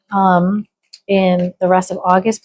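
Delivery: ordinary talk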